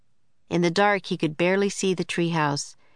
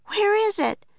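put-on high-pitched young Annie calling out; a high, urgent girl's cry; Annie shouting. A narrator's reading. Annie searching aboard the sinking Titanic, high urgent shout. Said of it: "shouted Annie".

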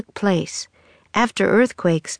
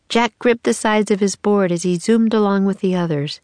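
ordinary delivery, nothing marked

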